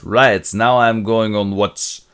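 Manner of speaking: put-on slow, loud drunken announcement; hammed-up boozy bravado. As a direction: slur it out slowly like a tipsy officer. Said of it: "So he's drunk".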